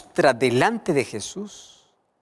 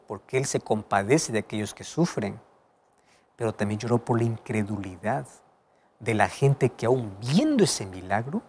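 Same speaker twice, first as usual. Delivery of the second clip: ordinary talk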